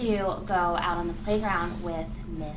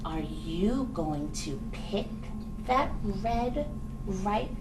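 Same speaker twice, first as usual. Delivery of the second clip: lower, slow, hushed story voice; reading to a toddler; 5 semitones lower, 3 dB quieter, slower than usual. Acted. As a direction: coax the little mouse gently. Reads storybook narrator to mouse.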